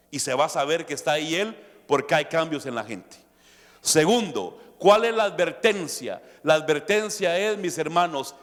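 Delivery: ordinary delivery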